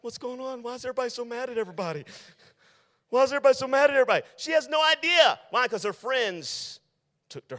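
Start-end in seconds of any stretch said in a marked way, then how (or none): 0.0-4.2 confused victim. Acted. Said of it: "is like".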